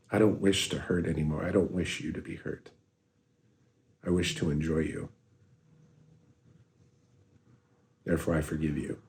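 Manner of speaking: soft, hushed and sincere; quiet earnest forgiveness; tender forgiveness. Real, not acted. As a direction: let each wish settle gently. Sincere forgiveness addressed to someone.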